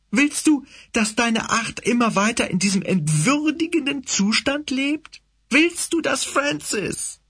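high, sneering villainous cat. Acted. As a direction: taunt him like a fanatic cat leader.

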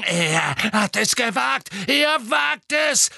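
slow, high, outraged screech; incoherent shriek. A narrator's reading. Bellatrix raving over Harry's insult, shrieking. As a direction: screech it as if beside yourself. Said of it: "kreischte Bellatrix zusammenhanglos".

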